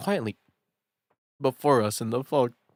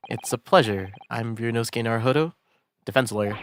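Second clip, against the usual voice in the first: ordinary talk, nothing marked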